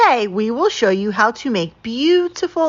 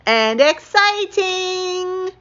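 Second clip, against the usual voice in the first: leaps high and stretches "exciting" very slowly; can't hold it in any longer; bursting, drawn-out squeal; sudden excited burst; 11 semitones higher, much slower than usual. Acted. Skunk bursts out again.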